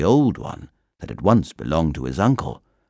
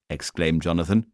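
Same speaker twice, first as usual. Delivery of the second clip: usual speaking voice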